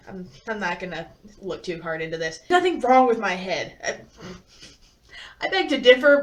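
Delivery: ordinary delivery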